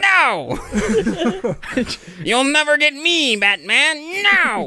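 high, slow comic villain voice; Penguin voice. Pretend. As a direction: sneer it like a Batman villain. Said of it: "It's literally the Penguin".

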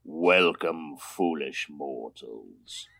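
deep, slow spooky host voice; creepy haunted house greeter; slow theatrical villain menace. Acted. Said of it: "Wonderful entrance, sir".

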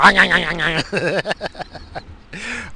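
long, loud, high cackle; laughing hard at his own crude joke; cackling laugh. Real, not acted. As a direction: break into a giddy cackle.

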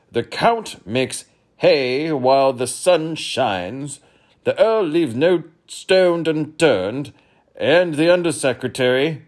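demanding king; pompous king. Acted. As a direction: quiz the boy grandly. King voice held.

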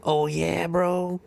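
hammed-up bro accent imitation; light mock accent; mock Mexican accent. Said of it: "they'd be like".

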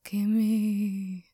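drawn-out ghost voice; ghostly wail.

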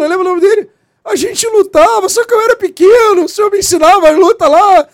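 high, loud, overjoyed retelling; a former student thrilled at meeting his teacher; amazed, almost tearful rush; excited recognition. Retold or imitated. Suspect recognizes old teacher.

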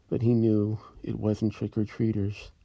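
ordinary delivery

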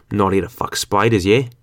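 imperfect, put-on Australian accent; shaky Aussie accent attempt. Newcomer imitating Aussie slang, imperfect Australian accent. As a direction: attempt an Aussie accent, not quite convincingly. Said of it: "that was not the best accent".

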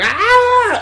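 loud sharp yell; rage shout. Retold or imitated. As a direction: roar after the last rep. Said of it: "he's like".

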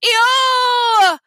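loud, high, long-drawn ew; disgusted cry. A narrator's reading. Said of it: "Sheila cried, holding her nose".

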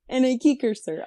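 lightly imitated cartoon sidekick, starting high; echoed cartoon impression. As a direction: copy the mock Russian cartoon voice.

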